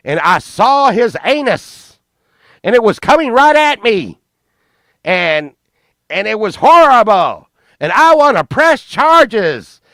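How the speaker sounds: high, loud imitation of an indignant woman; mock outraged accuser. Miss Bounds accuses the other child to police, mock outraged.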